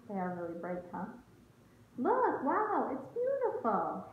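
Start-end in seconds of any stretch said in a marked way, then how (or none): 2.0-4.0 high, delighted wow, then slower; awed teacher voice; amazed. Real, not acted. Marvelling at picture for children.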